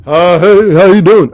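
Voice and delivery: boisterous mock greeting; loud goofy greeting. Shown as a demonstration. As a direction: holler it like a goof. Demonstrating goofy movement.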